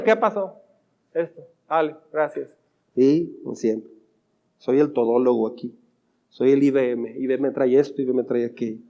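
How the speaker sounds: low, soft grumbling to oneself; inner monologue. Pretend. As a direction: mutter your tired thoughts under your breath. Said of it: "sigue tu diálogo interno".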